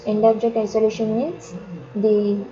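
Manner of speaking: usual speaking voice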